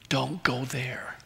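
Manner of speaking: slow, hushed whisper of warning. Pretend. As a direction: breathe the warning slowly and softly. The Lord giving a warning.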